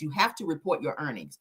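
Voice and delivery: usual speaking voice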